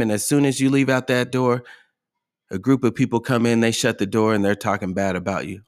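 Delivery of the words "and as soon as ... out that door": ordinary delivery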